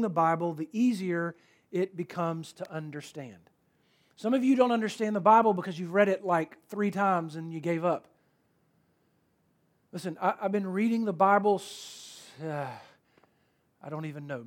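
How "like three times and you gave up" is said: normal talking voice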